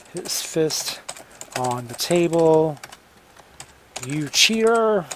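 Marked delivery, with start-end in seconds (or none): 4.1-5.1 shouted accusation, higher; angry accusation. A narrator's reading. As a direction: yell it like an accusation. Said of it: "he said".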